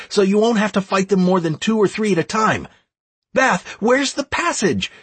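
high, pressing battlefield commands; shouting orders. A narrator's reading. Leader sending Stacy's lot to the gate, shouting orders. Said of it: "I continued to shout orders left and right".

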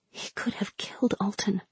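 sudden hushed whisper. A narrator's reading. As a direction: drop to a bare whisper. Narration drops to whisper.

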